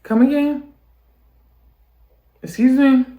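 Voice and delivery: high, loud come again; shocked disbelief. Real, not acted. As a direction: pitch way up in outrage. Reacting to creepy couple story.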